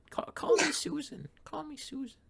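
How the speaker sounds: high, whispered seductive mom; flirty mom whisper. Acted.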